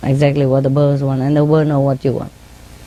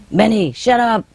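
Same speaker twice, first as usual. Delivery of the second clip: mild mock scolding, a bit higher; parrot telling off dogs; 4 semitones higher than usual. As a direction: lightly imitate a bird scolding the dog. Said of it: "she tell the dogs off".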